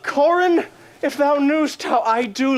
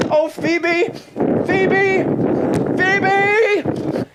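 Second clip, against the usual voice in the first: yearning cry; 9 semitones higher, much slower than usual. Acted. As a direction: call out her name, each time more desperate. Lover crying out beloved's name.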